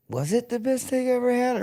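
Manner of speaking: very high-pitched questioning voice; mock wondering. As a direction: squeak the question out in exaggerated doubt. Mock wondering about the hyped steak.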